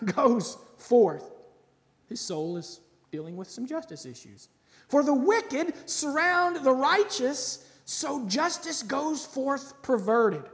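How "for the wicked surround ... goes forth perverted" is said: higher, louder cry of the prophet, slow; impassioned scripture reading. Acted. Preacher reads the prophet's cry to the congregation, impassioned. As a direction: read it as a desperate cry to heaven.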